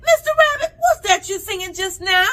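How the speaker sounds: put-on girl grabs the rabbit; triumphant demand. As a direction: pounce with glee.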